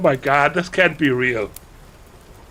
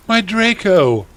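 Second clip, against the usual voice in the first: high, loud, hammed-up lament; moaning; 11 semitones higher, 6 dB louder than usual. A narrator's reading. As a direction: wail for a lost love. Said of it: "he moaned".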